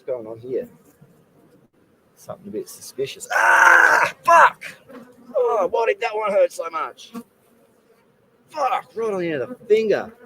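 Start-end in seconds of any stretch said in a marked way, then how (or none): 3.3-4.6 sharp high shout of pain; pained yelp. Real, not acted. Stung by a bee. 5.3-10.1 strained, high and pained. Real, not acted. Aftermath of painful sting.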